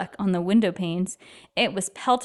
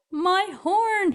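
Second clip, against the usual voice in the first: gulping in dismay; 10 semitones higher than usual. Acted. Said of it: "he gulped".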